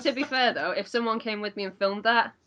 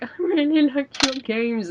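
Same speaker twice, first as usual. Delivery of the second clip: higher, pretend-weepy gushing; mock tearful fan; 7 semitones higher than usual. Nervous fan meeting the developer, mock tearful. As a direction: gush as if about to cry, hammed up. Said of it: "and start crying".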